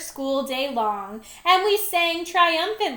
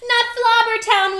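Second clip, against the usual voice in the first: higher, louder protest; shouted; 7 semitones higher, 5 dB louder than usual. A narrator's reading. Children protesting the dreaded school, shouted. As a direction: cry it out in dismay. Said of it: "we shouted".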